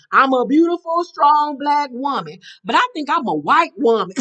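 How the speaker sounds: high, loud mimicry of an affirmation; Kaylin. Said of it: "And here go Kaylin".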